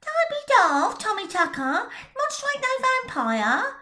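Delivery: put-on sharp girl telling him off; scornful retort. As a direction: put the silly boy in his place. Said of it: "Jenny Stocking retorted".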